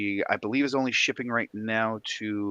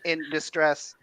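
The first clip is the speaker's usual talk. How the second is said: robot saying it's broken; 3 semitones higher than usual. Pretend. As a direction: mechanical robot voice. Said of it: "[name]'s gone robot voice again".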